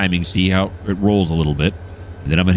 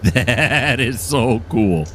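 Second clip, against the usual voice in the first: very slow, higher, drawn-out awe; awed; 4 semitones higher, much slower than usual. Real, not acted. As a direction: marvel at it, stretching every word.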